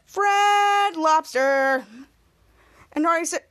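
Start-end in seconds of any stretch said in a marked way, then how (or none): 0.0-1.9 very drawn-out ad slogan; commercial jingle voice. Retold or imitated. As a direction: stretch each word like a jingle. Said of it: "the commercial said".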